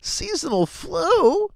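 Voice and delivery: very high, very slow, put-on outraged voice; voicing the S&P 500 chart bouncing back off the floor in disbelief; mock screaming market. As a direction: drag it out way up high like the chart itself is howling in disbelief. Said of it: "just screaming back off the floor going".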